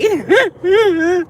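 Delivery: high shrieking laugh then a squealed oh my God; cackle, then squeal it high. Real, not acted. Shrieking at the thought.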